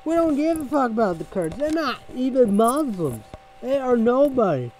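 loud, high, harsh shouting voice; a high, loud shouted tirade; ranting shout. Acted. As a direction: bellow it like a furious rant. Angry outburst about the Kurds.

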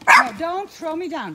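high, loud alarmed shout. Real, not acted. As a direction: cry out as dogs push you. Dogs jumping on her.

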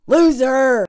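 a loud mocking jeer. Real, not acted. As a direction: shout the insult. Calls him a loser.

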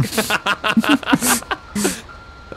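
gleeful laugh. Real, not acted. Gleeful at the trapped driver.

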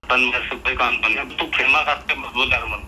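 usual speaking voice

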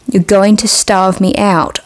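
ordinary delivery

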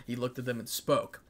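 ordinary delivery, nothing marked

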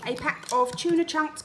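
normal talking voice

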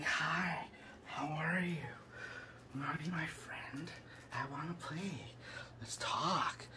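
soft, voiceless whisper, creepy and coaxing; eerie whispered entity voice. Pretend. Strange entity luring the listener into friendship, whispered. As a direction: breathe it like a strange being luring you closer. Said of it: "It's like".

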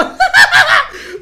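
long, high, loud cackle; gloating laugh. Real, not acted. Prankster after tricking two players, gloating laugh. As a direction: crack up at your own punchline. Troll laughs at own joke.

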